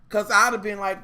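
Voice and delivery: usual speaking voice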